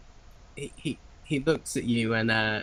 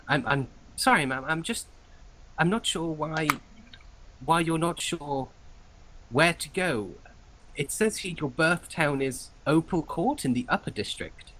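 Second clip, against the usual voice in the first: higher, fumbling official voice; nervous clerk; 7 semitones higher than usual. Acted. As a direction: play a flustered clerk apologising.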